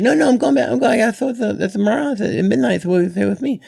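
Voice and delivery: high, loud mock Leno voice; imitating Jay Leno; Jay Leno impression. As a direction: insist cheerfully as Jay. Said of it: "He said".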